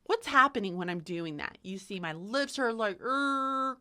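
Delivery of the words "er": higher, scrunched-lip "er". Shown as a demonstration. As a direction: scrunch the lips and hold "er". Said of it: "kinda scrunched".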